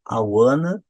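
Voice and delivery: "want to" run together as "wanna"; teacher demo of joined "wanna"; linked reduction. Shown as a demonstration. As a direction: model "I wanna" with a rising end. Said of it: "então ficou aqui".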